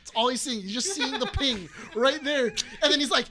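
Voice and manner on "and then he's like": usual speaking voice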